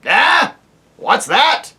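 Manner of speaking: put-on high, loud querulous old dwarf; deaf old dwarf. Deaf dwarf to the owl, loud querulous old man. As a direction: bark it like an old man hard of hearing.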